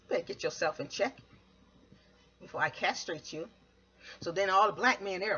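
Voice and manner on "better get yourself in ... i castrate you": lower, soft menacing warning; stern warning. Retold or imitated.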